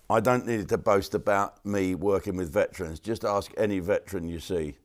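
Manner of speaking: ordinary delivery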